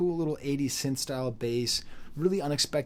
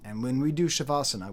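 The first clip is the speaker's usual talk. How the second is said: usual speaking voice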